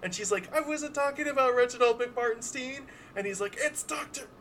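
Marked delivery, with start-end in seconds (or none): 0.6-2.8 high character voice. Acted. Hatchet Jones voiced high. 3.6-4.3 high character voice. Acted. Doctor corrects, high voice.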